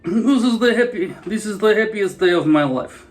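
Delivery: loud, high, laughing mock Russian; overjoyed Russian caricature, laughing through it; Russian accent. Russian accent, proclaiming his joy to viewers. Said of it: "Mother Russia".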